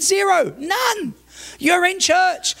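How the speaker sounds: high, forceful retort; retold argument at high pitch; emphatic refusal. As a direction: shut the request down hard. Pastor refuses in retold quarrel.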